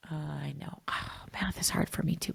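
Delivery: soft whispered comfort; soothing whisper. Acted. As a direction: whisper it like calming a child. Soothing the upset child.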